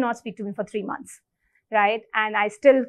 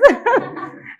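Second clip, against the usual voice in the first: a burst of high, bright laughter; real laugh; 13 semitones higher, 4 dB louder than usual. Real, not acted. Laughing at her own old temper while telling the story. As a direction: laugh out loud at the memory.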